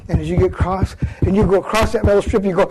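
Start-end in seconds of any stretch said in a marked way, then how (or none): none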